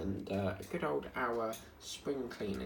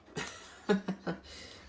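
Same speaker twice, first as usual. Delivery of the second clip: breathy laugh; 8 semitones higher than usual. Real, not acted. Laughs at own joke.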